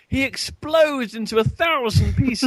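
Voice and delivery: high, emphatic exclamation; high-pitched comic outrage; incredulous. Real, not acted. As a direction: exclaim it in disbelief. Incredulous exaggerated retelling.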